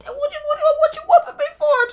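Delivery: very high, loud comic wail; high-pitched wailing protest. Retold or imitated. Teen to mom during a whooping, high loud wail. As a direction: squeal out a hammed-up protest.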